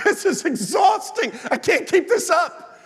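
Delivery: high-pitched, worn-out whine to friends; exasperated complaint. Acted. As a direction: act out fed-up exhaustion, pitched high. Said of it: "he tells his friends, he's like".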